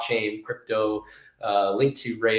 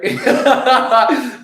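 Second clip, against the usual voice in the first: real laugh; 13 semitones higher, 10 dB louder than usual. Real, not acted. Laughs at his own remark.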